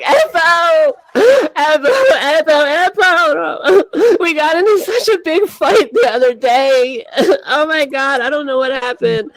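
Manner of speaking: high, hammed-up sobbing wail; mock crying. Friend phones at two o'clock after a big fight, mock crying. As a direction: imitate a friend wailing through a messy cry. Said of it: "she's crying like a hot mess".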